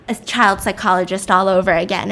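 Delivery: usual speaking voice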